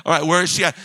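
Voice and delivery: hammed-up sideline parent, loud; a touch of the overbearing soccer dad; sports dad act. Dad checking up on coach and daughter, lightly mock demanding. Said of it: "And I'm just like".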